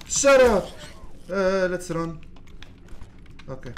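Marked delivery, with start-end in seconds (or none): 0.1-0.7 loud, high yell; annoyed shout. Real, not acted. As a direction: bark it at them. Shouting at critters.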